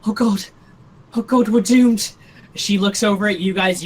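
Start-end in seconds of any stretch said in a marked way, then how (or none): 0.1-2.1 louder, higher cry of doom; acted scared. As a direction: play a frightened character in dismay. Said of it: "she's pretty scared right now".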